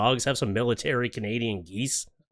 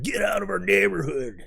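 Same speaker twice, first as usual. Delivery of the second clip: higher, put-on gang goose voice; voicing the geese; 7 semitones higher than usual. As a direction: warn off the intruder like street toughs. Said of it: "they're like".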